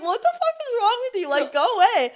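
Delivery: high, loud yelling through laughter; laughing yell. Real, not acted. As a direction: yell it while cracking up. Laughing while yelling at Carl.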